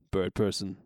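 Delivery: low, deadpan bird-man voice; mock-solemn low impression; Bird Person. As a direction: speak in a deep, measured monotone. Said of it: "does he talk like this?".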